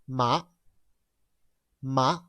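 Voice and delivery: short "Ma" lifting at the end; "Ma" going up like a question; rising tone. Shown as a demonstration. Class repeats after teacher, short rising "Ma". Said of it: "Similar to the pronunciation. What?".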